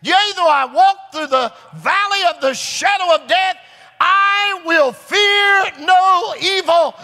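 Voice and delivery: high, loud, impassioned preacher shout; full-force preaching. Acted. Preacher assuring a dying man by scripture, shouted sermon. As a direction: build to long, drawn-out cries of scripture.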